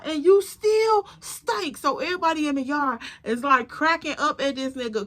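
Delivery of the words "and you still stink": high, slow mocking jab; imitated slow, high-pitched taunt; mocking jeer. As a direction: draw it out high, taunting the person. Acted jeer in retold quarrel.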